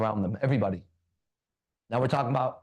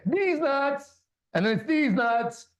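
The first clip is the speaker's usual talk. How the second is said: punchline shout; 11 semitones higher, slower than usual. Acted. Joke punchline.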